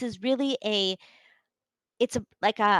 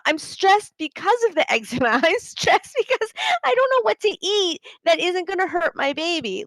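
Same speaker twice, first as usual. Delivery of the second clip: much higher and louder, incredulous outburst; exasperated retort; 6 semitones higher, 4 dB louder than usual. Retold or imitated. Mother snapping back at the doctor, exasperated and loud. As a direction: fire it back like it's obvious.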